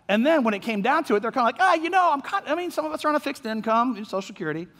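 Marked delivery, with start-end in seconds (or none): 1.6-4.7 high-pitched mock hedging; halting, higher excuse-maker voice; reluctant excuse. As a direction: imitate someone wriggling out of a promise. Imitated people backing out with excuses.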